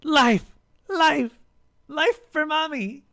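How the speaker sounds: high, squealed cheers; gleeful high-pitched chanting; children shouting. A narrator's reading. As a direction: shout it in a little creature's voice.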